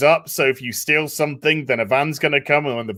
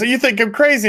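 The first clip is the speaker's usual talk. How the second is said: higher, indignant woman's question; higher mock woman; 9 semitones higher, 4 dB louder than usual. The woman challenging the agent, higher-pitched. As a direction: squeak it in offended surprise. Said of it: "she says".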